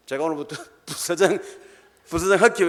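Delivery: laughing mock boast to the boss; cocky boast. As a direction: brag your way in with a laugh. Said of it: "이러면".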